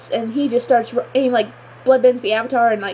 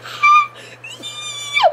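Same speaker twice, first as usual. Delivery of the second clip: very high excited squeal; fangirl squeal. Real, not acted. Fan hearing the boomerang line, shrieking squeal of delight. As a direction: squeal like the show just made your day. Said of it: "squealing right now".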